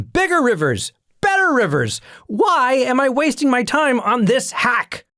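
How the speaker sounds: high, loud, exasperated rant; a proud commander fuming about the little river; frustrated outburst. Pretend. Naaman ranting at the prophet's snub, high and loud. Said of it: "you can practically hear his frustration seeping through".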